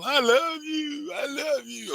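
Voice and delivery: very high, drawn-out mock wail; putting on a show. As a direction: mimic her clingy devotion, stretched out. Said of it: "the way she hollered at her mama".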